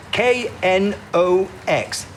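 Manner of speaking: slow, separated letters, K N O X; exasperated spelling out. Acted. Spelling name for phone operator.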